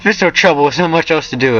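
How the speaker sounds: high put-on voice, sliding down; high-pitched polite reply; character dialogue. A narrator's reading. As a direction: pitch it up, easy and reassuring.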